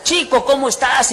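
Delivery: loud, high-pitched, exuberant greeting; boisterous Venezuelan. Retold or imitated. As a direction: greet like a rowdy Venezuelan friend. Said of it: "Ya ven que son ellos escandalosos". (imitando a un venezolano escandaloso)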